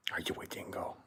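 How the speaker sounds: hushed, teasing whisper to a pet; playful whisper. Real, not acted. Owner asking his dog if it is a dingo, whispered.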